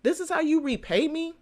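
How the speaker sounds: high, hurt complaint; wounded, pleading for gratitude. Retold or imitated. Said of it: "I'm like".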